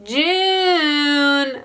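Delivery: high, drawn-out "June, June"; toddler calling out. Retold or imitated. Mom imitates her daughter calling her sister, high and drawn out. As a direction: call out long and high like a toddler. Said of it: "like, calling for Violet".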